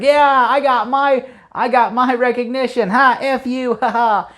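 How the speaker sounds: high, slow, mocking victory crow; hammed-up gloating with a cackle; smug gloat. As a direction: rub the win in their faces. [name] gloating after the win.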